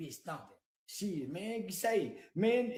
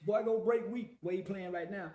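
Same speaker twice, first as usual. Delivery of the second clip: usual speaking voice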